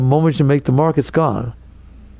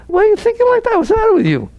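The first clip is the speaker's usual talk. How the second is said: high scolding; faster than usual. Acted. Scolds the worried mind.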